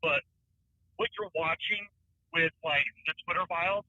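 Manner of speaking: faint caller over a bad line; distant, broken phone audio; phone speaker. Real, not acted. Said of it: "I can barely hear you".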